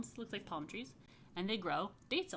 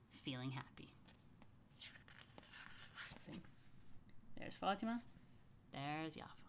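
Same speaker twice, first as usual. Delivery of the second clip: whisper; 4 semitones lower, 15 dB quieter than usual. A narrator's reading. Narration aside.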